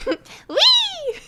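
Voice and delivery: shrill gleeful squeal of a laugh; manic high laugh. Pretend. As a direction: cackle like a hyped anime girl. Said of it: "doing Callisto Yu's laugh".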